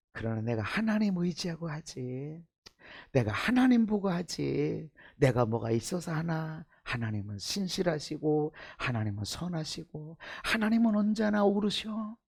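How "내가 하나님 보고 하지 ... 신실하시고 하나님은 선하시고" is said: normal talking voice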